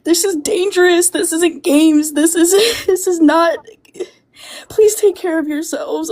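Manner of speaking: ordinary delivery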